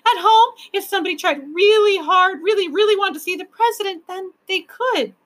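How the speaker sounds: put-on high-pitched, insistent child's wail. A narrator's reading. Lindy insisting to the others, wailing. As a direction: keep wailing high, arguing the case. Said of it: "she wailed".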